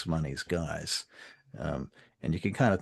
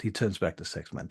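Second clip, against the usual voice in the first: ordinary talk, nothing marked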